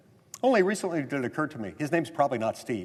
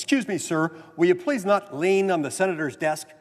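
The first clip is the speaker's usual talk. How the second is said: a security guard's harsh, louder challenge; stern guard telling a senator off, louder; 7 semitones higher, 6 dB louder than usual. Retold or imitated. Security guard scolding a new senator, harsh and louder. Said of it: "somewhat harsh tone of voice".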